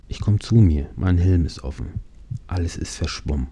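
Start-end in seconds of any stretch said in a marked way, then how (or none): none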